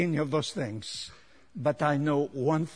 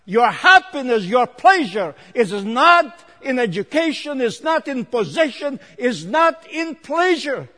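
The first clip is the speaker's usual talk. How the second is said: loud, high sermon delivery; full-force preaching; 9 semitones higher, 11 dB louder than usual. Real, not acted. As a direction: preach it out to the whole congregation.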